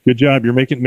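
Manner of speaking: normal talking voice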